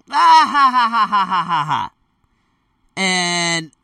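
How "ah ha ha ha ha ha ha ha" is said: mock laughter. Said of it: "He's like".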